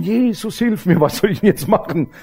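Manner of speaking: re-enacted frantic prayer; retold desperate cry. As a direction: imitate a panicked plea without shouting. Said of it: "ich hab nur geschrien".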